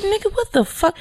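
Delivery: high, startled what-the; incredulous rebuff. Retold or imitated. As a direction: brush him off in shock. Rebuffing stranger's crude advance.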